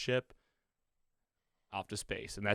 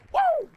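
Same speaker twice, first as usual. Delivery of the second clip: loud, high-pitched whoop; excited whoop; 12 dB louder than usual. Real, not acted. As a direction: let out a big cheering woo. Cheers after the right guess.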